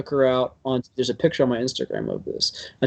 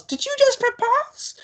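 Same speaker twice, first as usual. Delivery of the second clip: high falsetto squeal, slow and drawn out; mimicking woman; slower than usual. Passerby woman calls to the couple, squealing falsetto. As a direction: play the stranger, squealing with delight. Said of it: "this woman yells".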